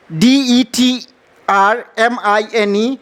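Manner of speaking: letters read out one by one, well apart; slow letter spelling. Shown as a demonstration. Teacher spells the word aloud for the class, slowly. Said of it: "इसे हम determine नहीं पढ़ेंगे".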